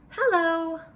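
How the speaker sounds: high, loud cheerful call; character calling hello. Acted. Girl in the story greeting Beagle, high loud call. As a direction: call out brightly to a new friend.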